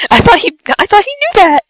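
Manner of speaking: high-pitched mock whine; defensive protest. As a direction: plead innocence in a high voice. Protesting to her blaming mother.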